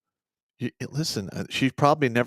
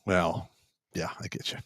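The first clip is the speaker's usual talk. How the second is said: breathy whisper after a sigh; barely voiced, resigned; 5 semitones lower, 8 dB quieter than usual. Real, not acted. Sigh, grim agreement on disturbing case.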